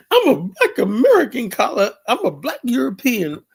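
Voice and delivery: high, loud and laughing mimicry of a caller; mocking through laughter. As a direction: squeal it out while cracking up at them.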